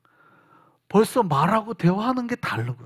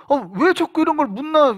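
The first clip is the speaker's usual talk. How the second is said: puzzled reply; 8 semitones higher, 4 dB louder than usual. Retold or imitated. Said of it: "그러면".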